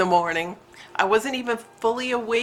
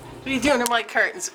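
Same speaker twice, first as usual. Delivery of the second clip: quick scolding; 3 semitones higher, faster than usual. Real, not acted. Owner to cat at curtains, quick muted scolding. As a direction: rattle off the question quickly.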